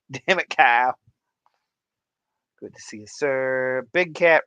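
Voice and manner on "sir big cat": normal talking voice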